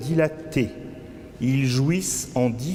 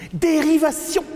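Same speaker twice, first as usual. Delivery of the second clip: high ringing outburst; sudden high-pitched poetic exclamation; sharp interjection; 12 semitones higher, 4 dB louder, faster than usual. Acted. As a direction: fire the word out high. Interjection within poem.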